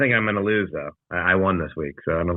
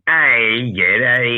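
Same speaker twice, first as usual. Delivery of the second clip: mock Australian accent; 4 semitones higher, 6 dB louder, much slower than usual. Greeting bit, then talk of bad accents.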